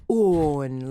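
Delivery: "on" with a long British vowel; British vowel drill. Shown as a demonstration. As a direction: draw out "on" like a clumsy British exercise. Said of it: "I'm doing it really horribly now".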